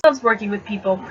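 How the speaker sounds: plain reading voice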